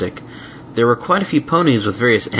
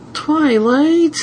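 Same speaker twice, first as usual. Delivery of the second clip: heartbroken; 4 dB louder than usual. A narrator's reading.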